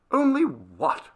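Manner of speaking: sharp, high-pitched demand; impatient outburst; growing angry. Acted. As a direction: cut in with rising irritation. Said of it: "growing angry".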